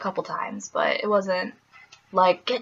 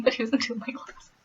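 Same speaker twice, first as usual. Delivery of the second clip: ordinary talk, nothing marked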